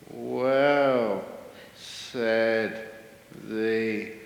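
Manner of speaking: one word at a time, long pauses between; comically unhurried, drawn-out delivery up to the punchline; very slow talker. Acted. Meditator explains the tortoise escape to head keeper, painfully slow. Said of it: "who can't even talk fast".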